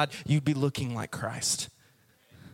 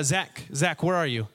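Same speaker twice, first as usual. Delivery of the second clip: usual speaking voice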